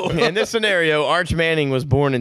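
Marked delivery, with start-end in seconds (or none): 0.0-1.0 laughing through the words, a bit higher; amused laugh mid-phrase. Real, not acted. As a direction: chuckle it out while talking. Laughing while repeating.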